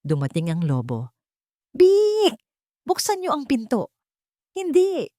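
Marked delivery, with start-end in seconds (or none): 1.8-3.9 much higher, loud wolf cry at the door; hammed-up hungry wolf demanding the door; wolf calling out. The wolf calling to the piglets to open the door, high and loud. 4.6-5.1 high shouted refusal. Acted. Two piglets yelling no at the wolf, high. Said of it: "sabay nilang sigaw".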